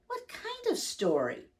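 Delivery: put-on a high, light little-boy voice; a hint of a curious child at bedtime. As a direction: ask it like an eager small child. Child Cole's line in story.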